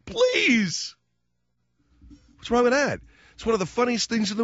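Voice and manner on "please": high pleading please; mock begging the kids. Host begging the kids to pull the prank, pleading. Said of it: "I begged".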